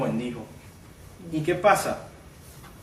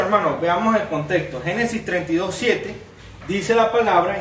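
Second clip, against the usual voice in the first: normal talking voice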